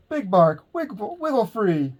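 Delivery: high, loud call upward; shouting. A narrator's reading. A prairie dog shouting up to Big Bark in the talons. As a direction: shout up to the sky. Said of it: "the prairie dog shouted".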